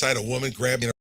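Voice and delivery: usual speaking voice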